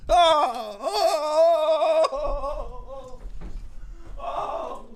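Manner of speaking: long high "oh" fading away; hammed-up wail of distress, trailing off; mock swooning wail. Streamer mocking his accuser's drama, mock swooning wail.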